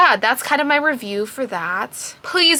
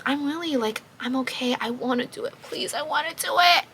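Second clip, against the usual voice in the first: much softer, breathy-light plea; soft eager reply; 2 semitones higher, 8 dB quieter than usual. Retold or imitated. Heroine agrees to her lover, soft eager reply. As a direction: murmur it, eager and shy. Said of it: "And she goes".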